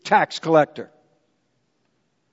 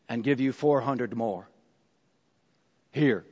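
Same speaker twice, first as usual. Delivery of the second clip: humble confession; 9 semitones lower, 7 dB quieter than usual. Pretend. Zacchaeus confessing.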